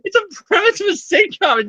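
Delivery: high, laughing delivery; cracking up. Real, not acted. Podcaster pitching the sitcom idea to co-host, cracking up. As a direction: squeeze it out through real laughter.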